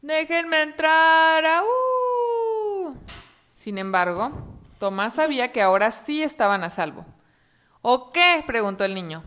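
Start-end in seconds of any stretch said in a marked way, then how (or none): 0.0-3.0 slow, high sing-song wolf howl; drawn-out high howling wolf. Acted. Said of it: "aulló". 7.8-8.4 high loud taunt. Acted. Boy taunts the wolf.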